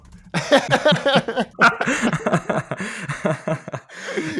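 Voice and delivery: long, high-pitched burst of laughter; big real laugh. Real, not acted. Guest cracking up at own intro idea, high laughing fit. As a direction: crack up helplessly, loud and high.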